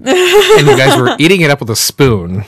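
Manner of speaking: laughing loudly through the words. Real, not acted. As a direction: crack up while teasing them. Laughing at fooling the others.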